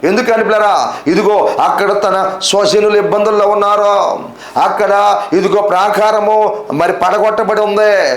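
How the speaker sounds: high, sustained preaching cadence; fervent preaching. Real, not acted. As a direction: build the list phrase by phrase, pitch held up. Preacher builds a list of troubles. (ఆవేశంగా, ఎత్తైన స్వరంతో ప్రసంగం)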